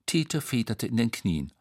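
ordinary delivery

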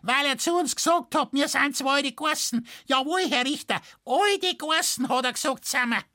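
very high, piercing Bavarian screech; shrill, indignant interruption in falsetto; shrill farmer's wife. A narrator's reading. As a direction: cut in as an outraged peasant woman. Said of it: "Da schrillte die Stimme".

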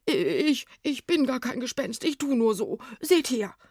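high, stammering plea; frightened pleading; panicked confession. A narrator's reading. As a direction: stammer it out, desperate to be believed. Scared ghost pleads, confesses disguise.

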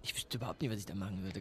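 drops to a bare whisper; sudden whisper. Acted. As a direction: whisper it, lost for an answer. Flüstert plötzlich ratlos. (plötzlich geflüstert)

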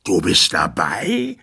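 slow, loud furious question; angry shout. Acted. Tyrant rages at the dazed dwarf, furious shout. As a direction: throw it back at him in rage. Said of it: "schrie der Tyrann wütend".

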